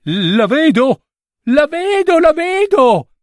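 very high, drawn-out excited cry; the little prince squealing with joy at seeing; joyful cry. A narrator's reading. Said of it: "si mise a gridare".

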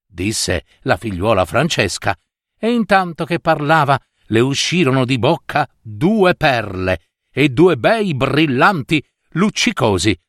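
usual speaking voice

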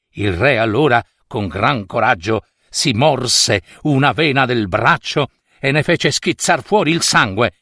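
ordinary delivery, nothing marked

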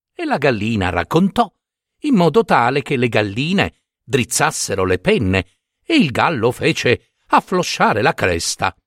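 ordinary delivery

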